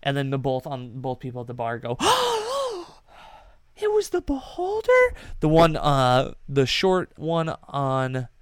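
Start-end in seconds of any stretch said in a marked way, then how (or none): 2.0-2.8 sharp high gasp of surprise; shocked gasp. Acted. Bar patrons gasp together at the reveal, shocked. 3.8-5.1 high-pitched disbelieving question; stunned question. Acted. Bar patron stunned by the beholder reveal, high disbelief.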